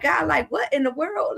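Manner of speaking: high, exasperated plea to God; exasperated complaint. Retold or imitated. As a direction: throw the question up in disbelief. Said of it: "and he like".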